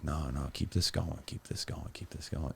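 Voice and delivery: hushed tempting devil voice; evil inner voice. Retold or imitated. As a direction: coax it in a near whisper. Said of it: "like, voice that ... the devil or whatever, that evil".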